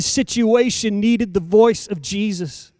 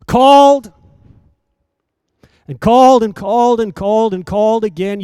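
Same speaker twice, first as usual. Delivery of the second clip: higher, louder, hammering each called; building preaching; 5 semitones higher, 10 dB louder than usual. Acted. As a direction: drive the repetition home to the crowd.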